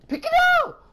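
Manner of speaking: loud, high frantic shout; urgent shout. Real, not acted. Streamer yelling at himself to grab an item. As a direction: yell in a hurry to grab it.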